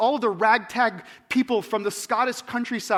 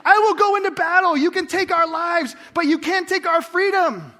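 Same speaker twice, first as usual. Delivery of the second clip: big shouted Braveheart battle cry, much higher and louder; rallying battle cry; 8 semitones higher, 8 dB louder than usual. Retold or imitated. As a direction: roar it like a man ready to charge into battle. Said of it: "I was, like, standing, like".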